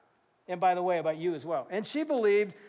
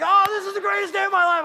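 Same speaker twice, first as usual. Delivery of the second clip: much higher and louder, overjoyed; ecstatic joy; 11 semitones higher, 10 dB louder than usual. Retold or imitated. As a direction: burst out with joy. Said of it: "I was like".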